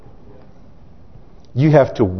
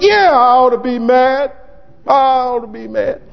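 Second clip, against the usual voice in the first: defiant Jonah; 8 semitones higher than usual. Acted. Jonah answers God defiantly.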